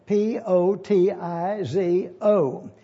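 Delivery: P O T I Z O spelled letter by letter, very slowly. Shown as a demonstration. Teacher spelling the Greek word for the class, slowly. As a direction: spell P O T I Z O out, one letter at a time.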